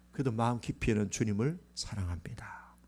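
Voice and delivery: hushed whisper to the congregation. Acted. Pastor whispers Peter's hidden love to the church. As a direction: lean in and whisper it. Intimate confession in sermon.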